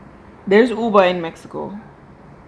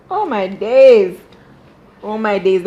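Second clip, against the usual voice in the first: exasperated oh my days; 2 semitones higher, 9 dB louder, slower than usual. Real, not acted. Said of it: "reacting to the scene".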